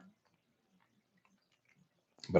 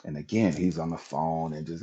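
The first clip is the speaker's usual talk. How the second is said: usual speaking voice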